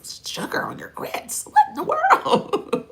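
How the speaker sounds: incredulous, pitch leaping up high; aghast disbelief, jumping high; mock shocked husbands. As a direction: play the scandalized Southern husband. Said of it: "they was like".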